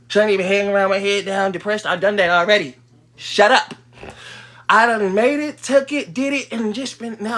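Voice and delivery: loud, frustrated rant; exasperated loud venting. Real, not acted. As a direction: vent at the viewers, fed up. Venting at viewers.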